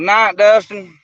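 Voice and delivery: speaking up slowly into radio; loud, drawn out radio talk. Real, not acted. Signing off on radio.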